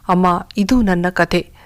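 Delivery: plain reading voice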